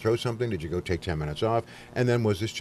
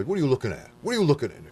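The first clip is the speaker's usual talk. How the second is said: higher-pitched confrontational demand, mildly acted; angry challenge; 6 semitones higher, faster than usual. Guest shows host how angry people react, mock angry challenge. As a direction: act out someone spoiling for a fight. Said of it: "There are some people who anger".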